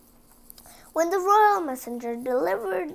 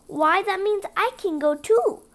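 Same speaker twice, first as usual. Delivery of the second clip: put-on higher, louder eager girl voice; hopeful; 7 semitones higher, 6 dB louder than usual. Cinderella hopes to go to the ball, higher and eager. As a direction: brighten with sudden hope. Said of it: "said Cinderella hopefully".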